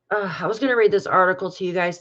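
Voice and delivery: ordinary talk, nothing marked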